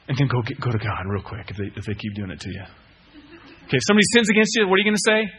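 usual speaking voice

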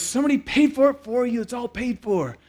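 high-pitched, stretched-up voice; insistent explaining. Retold or imitated. Manager to baffled customer, high insistent explanation. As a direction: pitch it way up to convince them.

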